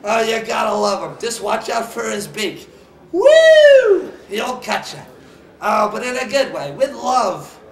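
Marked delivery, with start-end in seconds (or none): 3.1-4.1 high, loud "woo"; excited whoop. Real, not acted. Host cheering a mascot with a dangerous beak, excited whoop.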